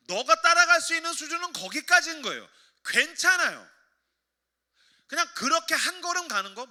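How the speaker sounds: loud, high-pitched sermon delivery; impassioned preaching. Real, not acted. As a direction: drive each phrase home like a preacher. Preaches at full force.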